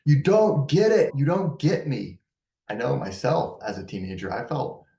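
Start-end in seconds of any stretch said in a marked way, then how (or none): 0.1-1.1 put-on high, loud frustrated teenager; angsty teen outburst. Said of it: "angsty and frustrated". 1.2-2.2 put-on angsty teen. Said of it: "angsty and frustrated".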